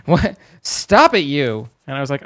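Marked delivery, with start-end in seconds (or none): 0.1-0.4 loud, high telling-off; exasperated yelling at yourself; mock self-scolding. Streamer retells scolding himself, loud and exasperated. 0.6-1.7 mock scolding. Streamer scolding himself over a pun, loud mock scolding.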